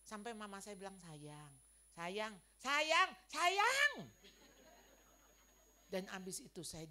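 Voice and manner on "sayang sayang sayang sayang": imitated exasperated mother. Imitating mother's exasperated repeated reply.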